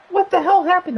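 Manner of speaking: high, shocked sister voice; mock sister. Sister reacting to the catnip mess, high shocked whine. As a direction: pitch it way up in alarmed disbelief. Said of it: "She goes".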